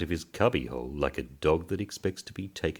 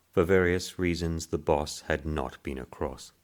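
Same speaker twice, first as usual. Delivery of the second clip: plain reading voice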